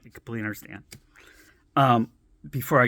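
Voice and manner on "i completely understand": low, soft agreement; soft polite brush-off; placating murmur. Retold or imitated. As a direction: mutter it to end the talk. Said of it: "I'm like".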